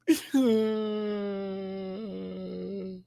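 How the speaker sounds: long, high, drawn-out fake whine; mock wail. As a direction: wail one long note in pretend grief. Mourning end of Dawn jokes.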